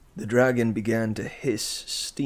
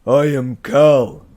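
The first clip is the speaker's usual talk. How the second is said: slow, very loud oaf voice; dim brute; 3 semitones higher, 14 dB louder, slower than usual. A narrator's reading. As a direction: boom it proudly. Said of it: "Cal repeated".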